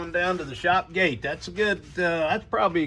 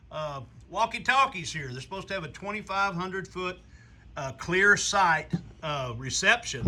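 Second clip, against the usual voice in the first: usual speaking voice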